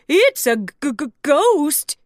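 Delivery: loud, stammering Goofy fright; terrified stammer. Acted. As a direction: stutter out the word ghost. Goofy gasped.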